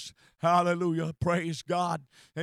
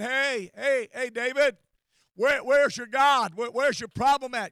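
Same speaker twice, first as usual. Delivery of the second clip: higher, louder mock challenge; taunting challenge; 5 semitones higher, 8 dB louder than usual. Scoffers to David in distress, taunting.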